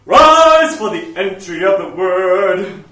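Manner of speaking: loud, slow, drawn-out liturgical call; booming mock procession call; ceremonial clergyman. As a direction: intone it like a pompous service announcement. Mimics clergy announcing liturgy.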